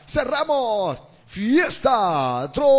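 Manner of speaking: slow, loud show-closing call; announcer voice. Acted. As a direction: close the show like a radio DJ. (voz de locutor, lenta y fuerte)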